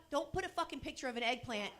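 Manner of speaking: ordinary talk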